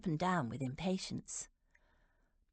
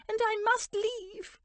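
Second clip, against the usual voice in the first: high, distressed complaint; fretful aunt in distress; 4 dB louder than usual. A narrator's reading. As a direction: appeal in a very high, upset voice. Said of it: "Aunt Dotty said distressfully".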